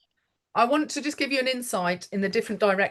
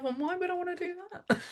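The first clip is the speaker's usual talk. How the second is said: wry inner thought; 10 semitones higher, 7 dB quieter, faster than usual. Retold or imitated. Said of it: "I'm thinking to myself".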